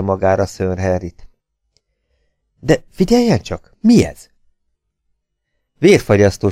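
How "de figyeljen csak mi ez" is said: sudden high, urgent question; startled alertness. A narrator's reading. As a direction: alert your companion to a sound, pitch jumping up. Speaker interrupted by a sudden scream. (riadtan felfigyelve)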